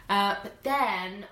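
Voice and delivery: ordinary talk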